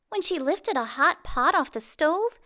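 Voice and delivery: ordinary delivery, nothing marked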